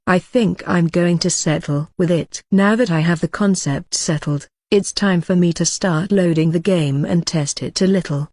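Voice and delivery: usual speaking voice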